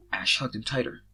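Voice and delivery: ordinary delivery, nothing marked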